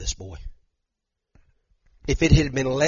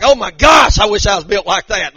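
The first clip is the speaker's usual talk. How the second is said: a much higher, louder shouted oh my gosh; envious exclamation; 6 semitones higher, 9 dB louder than usual. Retold or imitated. Preacher admiring Michael Phelps' build, shouting. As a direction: exclaim in mock envy.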